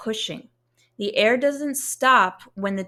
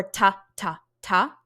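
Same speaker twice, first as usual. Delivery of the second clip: "ta, ta, ta" short, with gaps between; detached T articulation. Shown as a demonstration. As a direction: clip each "ta" off with a crisp T. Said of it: "So it's not".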